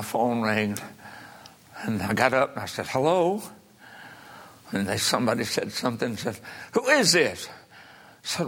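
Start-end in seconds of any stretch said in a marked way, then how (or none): 2.9-3.4 lightly acted questioning hello, pitched up; answering the phone. Mother answers the phone at eleven at night, lightly acted questioning voice. 6.7-7.5 higher-pitched acted query; questioning the caller. Mother asks who is phoning at night, acted high questioning voice.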